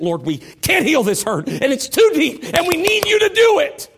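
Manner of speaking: building prayer cry. Acted. Voicing a prayer to God.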